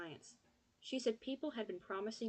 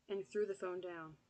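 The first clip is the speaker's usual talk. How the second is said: usual speaking voice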